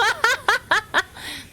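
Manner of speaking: high, rippling giggle; real amused laughter; laughing. Real, not acted. Amused by saying Evu.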